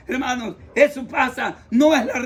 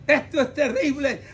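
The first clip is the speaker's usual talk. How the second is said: ordinary talk, nothing marked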